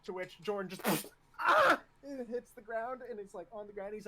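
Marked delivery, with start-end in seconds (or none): 1.4-1.8 sudden high, loud cry of pain; pained yelp. Acted. Recruit headbutted by instructor, pained yelp. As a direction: yelp as if struck hard.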